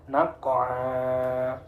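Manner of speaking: NAQA'A as 'naq-qo-aa', Q released with a bounce, long ending; bouncing qalqalah. Shown as a demonstration. Teacher shows qaf example, bouncing recitation. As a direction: bounce the stopped Q, then hold the last vowel. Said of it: "Qafnya mati di tengah".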